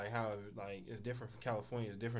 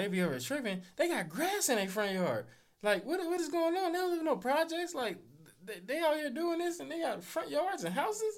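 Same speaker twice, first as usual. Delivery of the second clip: much higher, louder, incredulous comedic voice; cartoonish disbelief pitched way up; imitating the comedian; 4 dB louder than usual. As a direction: play a stunned comedian who can't believe front yards. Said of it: "He's like".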